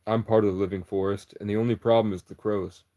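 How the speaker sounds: usual speaking voice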